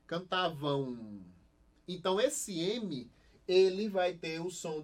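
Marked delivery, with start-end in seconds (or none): 0.1-1.2 Cantavam said slowly and lower, final am as nasal ow; slow pronunciation demo. Shown as a demonstration. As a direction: draw out Cantavam with a nasal ow ending. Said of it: "É o mesmo som desse daqui".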